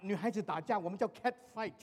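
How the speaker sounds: usual speaking voice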